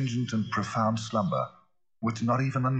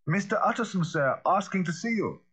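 high, projected announcement; calling out; 12 semitones higher than usual. A narrator's reading. Butler announces a visitor at the cabinet door, calling out. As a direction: call through a closed door, pitch high. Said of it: "he called".

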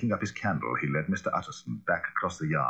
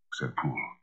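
ordinary delivery

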